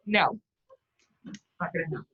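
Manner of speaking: ordinary delivery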